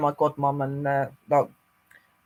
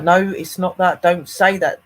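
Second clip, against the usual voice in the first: louder, higher retold objection; raised-voice retold protest; retold firm protest; 3 semitones higher, 7 dB louder, slower than usual. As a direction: push back loudly, as if retelling it. Retelling her alarmed protest to them.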